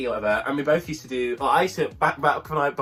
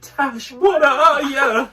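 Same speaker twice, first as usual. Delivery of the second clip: higher, louder, slow laughing disbelief; shocked cry; 13 semitones higher, 10 dB louder, much slower than usual. Retold or imitated. After a friend is pushed down stairs, shocked. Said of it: "I was like".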